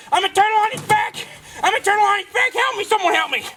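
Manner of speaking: high, fast, helpless cartoon cry; high panicked turtle. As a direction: squeal for help like a stuck turtle. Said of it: "I turn".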